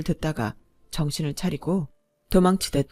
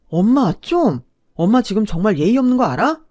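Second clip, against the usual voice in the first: louder, higher protest to mother; exasperated son; 4 semitones higher, 7 dB louder than usual. A narrator's reading. Son telling his mother she is being rude, exasperated. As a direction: rebuke her as the fed-up boyfriend.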